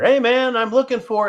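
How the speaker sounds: loud, high call across a bar; yelling. Retold or imitated. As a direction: holler to get the bartender's attention. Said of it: "I yell at the bartender".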